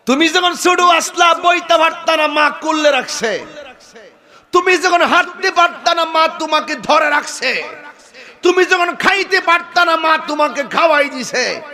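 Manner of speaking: high, loud impassioned preaching; fiery preaching. Acted. Preacher scolds the young man, shouting high and loud. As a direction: hammer each line at the young man.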